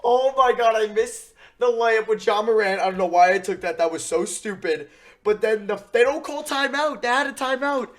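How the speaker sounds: loud, high exasperated rant; real disbelief, shouted; frustrated yelling. Real, not acted. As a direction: vent at the top of your lungs after a blown game. Losing the game, yelling.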